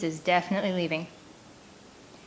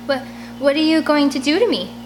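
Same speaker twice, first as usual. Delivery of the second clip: frightened plea; 7 semitones higher, 4 dB louder than usual. Acted. Frightened girl pleading with captor.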